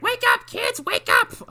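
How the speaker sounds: put-on very high child's call; hammed-up rousing shout; a kid running down the street waking everyone; mock yelling kid. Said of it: "going like".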